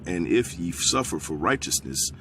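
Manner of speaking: quiet preacher reading from the Bible; scripture reading. Acted. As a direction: read the verse out, hushed and deliberate. Reads scripture aloud.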